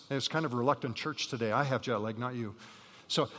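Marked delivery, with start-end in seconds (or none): none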